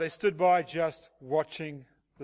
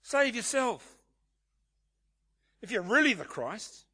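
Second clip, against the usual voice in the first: raised, louder sneering taunt; preacher voicing the scoffers' mockery, sneering; mocking scoff; 5 semitones higher, 4 dB louder than usual. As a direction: jeer at him as the rulers would. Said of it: "they scoff by blasphemy".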